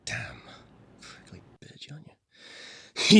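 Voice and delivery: whispered insult under the breath; muttered aside. Acted. Newcomer muttering an insult at the gunwoman, whispered. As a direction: hiss it low so only she hears.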